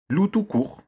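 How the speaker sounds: marmonné grognon, nettement plus aigu; râler en donnant son nom; bougon. A narrator's reading. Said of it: "bougonna Loup". (Loup, vexé, bougonne son nom à Gros Louis)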